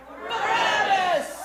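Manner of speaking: long, high, loud yell; crowd's shout. A narrator's reading. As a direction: roar it out slowly as a mob. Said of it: "the multitude spoke out".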